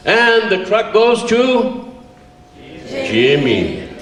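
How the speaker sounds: slow, high drawn-out announcer build-up; milk the suspense like a prize presenter; teacher teasing out the winner's name; big announcement. Retold or imitated.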